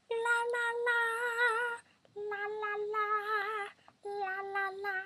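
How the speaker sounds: put-on very high little-girl la; high cutesy baby-voice la. Shown as a demonstration. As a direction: sing it tiny and high like a baby. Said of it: "if I wanted to have a cute baby voice".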